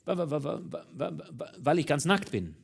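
stuck on the first sound, repeating it; stuttering. A narrator's reading. Naked minister stutters his excuse to the commissioner. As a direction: stutter helplessly before getting it out. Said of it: "Der andere stotterte".

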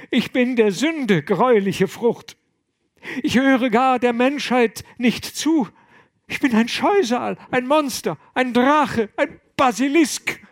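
high, loud anguished sobbing cry. Acted. Young monk sobbing on the abbot's shoulder, high loud wail. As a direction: wail out the self-loathing, near breaking. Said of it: "schluchzte Gregors".

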